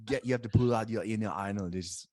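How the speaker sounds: loud, put-on German accent. Shown as a demonstration. Coaching a friend to find his inner accent, in a loud German accent. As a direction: push out a hammed-up German accent. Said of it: "can't even get a German accent".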